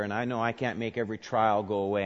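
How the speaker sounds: ordinary talk, nothing marked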